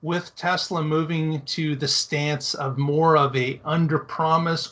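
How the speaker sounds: normal talking voice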